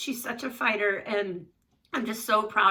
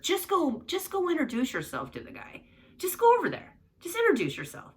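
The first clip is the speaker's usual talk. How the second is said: urging push; 9 semitones higher than usual. Retold or imitated. Mom urging daughter to go.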